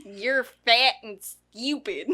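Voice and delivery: slow mocking imitation of a speech impediment; mama's impeded speech. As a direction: drag out each word with an odd twang. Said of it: "That's how she spoke".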